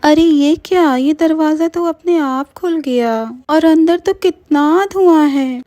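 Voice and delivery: high, wondering voice; startled. Acted. Meenal at the open door of the smoky flat, high and startled. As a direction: peer into the smoky room. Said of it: "Meenal खांसते हुए".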